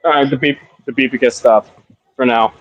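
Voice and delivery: normal talking voice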